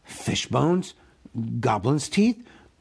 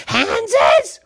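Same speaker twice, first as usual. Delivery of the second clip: high, loud creature cry; eager Gollum guess; 9 dB louder than usual. A narrator's reading. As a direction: blurt the guess like a cave creature.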